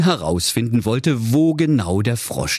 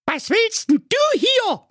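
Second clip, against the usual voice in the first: outraged very high squeal, drawn out; indignant princess, slow and squeaky. Acted. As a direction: challenge the intruder in a piping girl voice. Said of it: "fragte da die schöne Carola Knackwurst empört".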